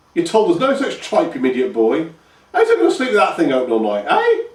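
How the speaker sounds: high, loud hag voice, scornful; scolding old grandma. A narrator's reading. Grandma scolding Pike, voiced as a shrill old crone. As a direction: scold the idiot boy in a crone's cackle.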